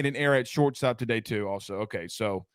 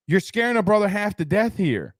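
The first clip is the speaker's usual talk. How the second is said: higher, mock-frightened whine; mock scared; 7 semitones higher than usual. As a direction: play up being spooked. Repeats the line more shrilly.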